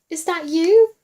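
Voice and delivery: ordinary delivery, nothing marked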